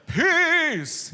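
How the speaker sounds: high, drawn-out operatic command; dramatic. Acted. Jesus speaks to the storm, dramatic. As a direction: cry it out grandly, high-pitched. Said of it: "imagine it in some dramatic way".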